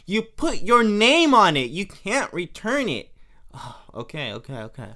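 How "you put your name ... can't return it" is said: louder, emphatic repeat of name on it; louder Marge. Retold or imitated. Marge catching Homer out over the gift, louder. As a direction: press it home, louder. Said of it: "And she goes".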